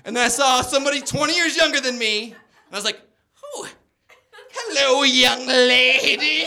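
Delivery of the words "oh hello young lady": high, loud, very slow creepy croon; hammed-up predatory hello; predator greeting. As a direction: stretch out young lady like a creep. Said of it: "that's how a predator walks".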